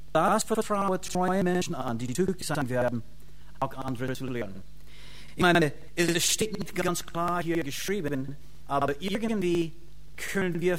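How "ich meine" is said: ordinary talk, nothing marked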